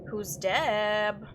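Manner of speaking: usual speaking voice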